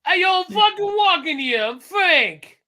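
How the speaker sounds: high, loud New York street holler; hammed-up New Yorker yelling; mock New York outburst; New York accent. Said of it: "like being in New York".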